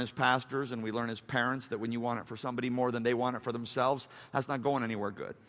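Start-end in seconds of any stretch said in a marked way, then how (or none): none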